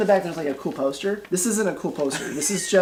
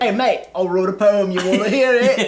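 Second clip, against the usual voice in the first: a hint of an Australian accent, higher and louder; lightly voiced Aussie henchman; 4 semitones higher, 6 dB louder than usual.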